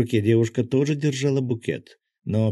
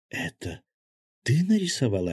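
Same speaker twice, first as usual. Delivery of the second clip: taken aback; 4 semitones higher than usual. A narrator's reading. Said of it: "опешила Аня".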